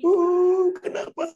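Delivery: high wailed moan, then small voice; hammed-up weeping wail; mock crying.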